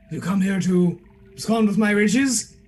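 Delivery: hammed-up eccentric gentleman, loud and fast at the end; eccentric Tinby. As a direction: boom it like a pompous host. Tinby demands why they came.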